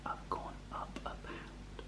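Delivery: hushed whisper; soft whispered disappointment. Real, not acted. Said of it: "I'm gonna do it quietly".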